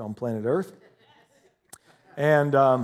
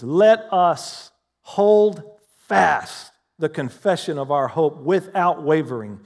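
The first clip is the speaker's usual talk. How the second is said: scripture reading; 3 semitones higher, 7 dB louder, slower than usual. Acted. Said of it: "Hebrews chapter ten and verse twenty-three says".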